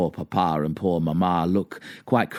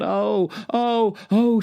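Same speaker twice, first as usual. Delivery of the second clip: high-pitched yelps of pain; acted high, loud cries; crying out; 4 dB louder, slower than usual. A narrator's reading. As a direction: yelp as if just cut, pitch leaping. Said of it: "Conrad cries out".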